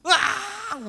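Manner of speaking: loud, high, drawn-out fake bawling; hammed-up crying cry; mock child's wail. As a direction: imitate a little boy wailing. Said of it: "슈바이처가 더 웁니다".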